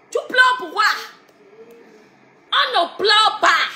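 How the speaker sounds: high mocking question; mock pity. Real, not acted. (fausse pitié, voix aiguë)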